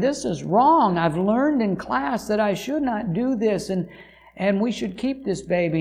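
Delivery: imitating her crying plea to keep the baby; retold tearful plea. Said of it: "crying and asked her boyfriend".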